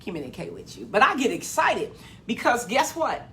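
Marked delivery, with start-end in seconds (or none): 0.0-0.9 dropped to a soft near-murmur, quickening; talking quietly. Real, not acted. Preacher to live viewers, suddenly hushed and fast. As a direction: pull way back so you don't talk loud. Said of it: "tell me if I'm real, talking real loud".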